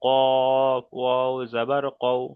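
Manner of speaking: very slow, with every sound of কফ ওয়াও জবর কও drawn out; slow model reading. Shown as a demonstration. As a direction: drag out each sound of কফ ওয়াও জবর কও for the student. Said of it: "টানগুলো দিন". (শিক্ষকের খুব ধীর, টেনে টেনে আদর্শ উচ্চারণ)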